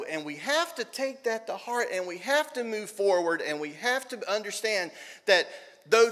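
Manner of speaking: rising pulpit fervour; preaching. Acted. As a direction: lift it into a full sermon push. Builds into full preaching.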